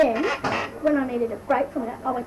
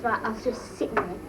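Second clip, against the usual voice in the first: ordinary delivery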